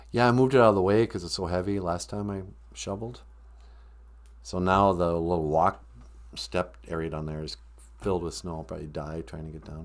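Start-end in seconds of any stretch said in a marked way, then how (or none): none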